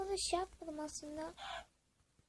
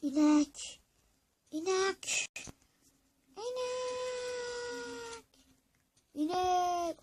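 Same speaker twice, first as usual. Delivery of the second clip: calling a cow; 8 semitones higher, much slower than usual. Real, not acted. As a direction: call İnek across the field, again and again.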